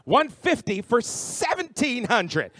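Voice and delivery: usual speaking voice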